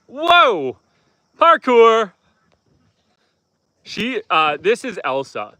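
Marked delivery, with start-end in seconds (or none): 0.1-2.1 high, loud delighted whoop; excited shout. Real, not acted. Owner cheering goat jumping off the propane tank, excited shout. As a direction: cheer the goat's jump like a stunt.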